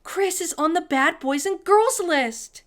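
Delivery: high, loud shocked outburst; crying out. A narrator's reading. Sophie finding her brother on the list, loud outburst. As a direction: blurt it out in outrage. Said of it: "she cried".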